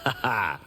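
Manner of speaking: short self-satisfied ha ha; a smug little chuckle; smug laugh. Acted. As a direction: laugh at how great you are. Smug fighter laughs at praise.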